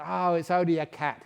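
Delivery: loud, offhand policeman; police dismissing. Retold or imitated. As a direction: wave it off loudly.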